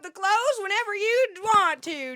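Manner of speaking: imitating mom's folksy Southern phone voice; Southern accent. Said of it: "still talking with a Southern accent".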